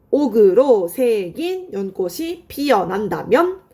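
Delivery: slow, measured reading of a verse; drawn-out teacherly read-through; slow recitation. Shown as a demonstration. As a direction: spell the line out phrase by phrase. Reciting second poem line for the class.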